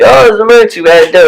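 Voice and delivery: high, sing-song excuse voice; mocking officials. Mocking the government's covid excuse, in a high sing-song parody. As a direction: parody the authorities' cop-out. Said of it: "They're like".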